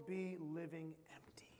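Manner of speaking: very soft, breath-led admission; hushed confession. Real, not acted. Preacher confessing his empty life to the church, hushed. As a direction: drop almost to a whisper.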